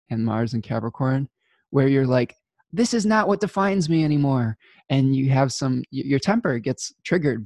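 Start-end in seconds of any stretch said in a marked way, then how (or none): 2.7-4.6 high-pitched mock protest; defiant outburst. Imagined person whose temper gets triggered, defiant outburst. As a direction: declare it high, standing your ground.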